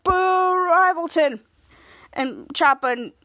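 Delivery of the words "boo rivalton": long drawn-out, loud, high boo; mock booing; jeering boo. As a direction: jeer slowly like a heckler. Character boos rival town.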